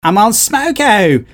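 high, drawn-out Aussie whine; Australian accent. Acted. Quoting a song's comeback to a co-host, Australian accent. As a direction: belt it out slowly in broad Australian. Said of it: "when you do your Australian accent".

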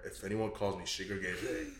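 low, soft self-directed menace; muttered mirror threat. Retold or imitated. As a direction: mutter a mock threat to your reflection. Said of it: "he's like, looks in the mirror".

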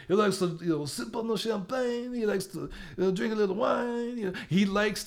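high-pitched, hammed-up French accent; horrible French accent. Host mocking a boxer who likes to eat, horrible French accent. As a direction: put on a bad, exaggerated French accent, pitched well up. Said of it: "That was my horrible French accent".

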